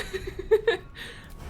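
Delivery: high, real giggling fit; laugh. Real, not acted. Still amused, after "Um.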